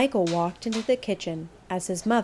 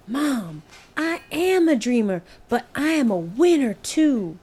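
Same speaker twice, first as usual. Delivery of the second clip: eager insistence; 5 semitones higher than usual. Acted. Boy insisting to mother.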